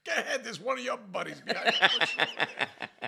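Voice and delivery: high, loud laughing mock wife; teasing wife. Wife teasing husband about the swearing neighbour, high and laughing.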